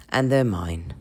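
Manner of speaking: ordinary talk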